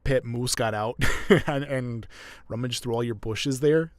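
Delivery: hammed-up Canadian with tightened throat. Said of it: "it's a stereotypical accent".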